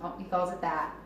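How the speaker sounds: ordinary delivery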